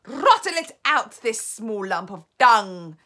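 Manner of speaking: very loud screeched order, high at first. A narrator's reading. Grand High Witch hunting the hidden child, screeching. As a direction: screech it, slowing with venom. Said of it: "screeched the Grand High Witch".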